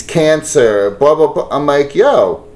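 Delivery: ordinary talk, nothing marked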